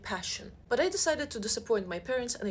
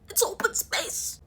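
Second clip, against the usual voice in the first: much higher, slow mock wail; distraught wail; 11 semitones higher, 4 dB louder, slower than usual. Daughter tells dad the awful news, slow mock wail. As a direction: wail it like a tragedy. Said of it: "I'm like".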